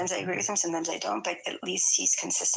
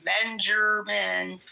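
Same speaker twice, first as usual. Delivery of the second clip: drawn-out call; much slower than usual. Real, not acted. Host calls a silent Benjamin, dragging the name out slowly. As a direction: stretch the name out slowly, calling.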